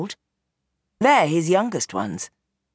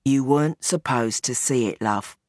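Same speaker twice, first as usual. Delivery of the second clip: lower, weary father pleading his case; old gangster Bernie; 4 semitones lower than usual. Acted. Gangster father explains himself to his daughter, low and weary. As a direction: sound like a tired old crime boss.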